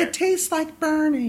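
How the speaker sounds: put-on high, slow, dopey kid voice; Ralph Wiggum. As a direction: say it like a dazed little boy. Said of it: "it's a Ralph Wiggum line".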